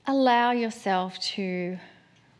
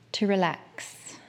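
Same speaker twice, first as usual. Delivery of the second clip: usual speaking voice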